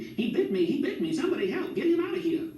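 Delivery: high, panicked shrieks for help; frantic lady screaming, very high. A narrator's reading. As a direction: shriek as the bee stings. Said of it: "Miss Love screamed".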